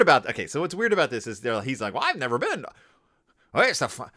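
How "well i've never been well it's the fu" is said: attempted Texas accent, abandoned midway. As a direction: have a shaky go at a Texas accent. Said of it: "I can't do a Texas accent".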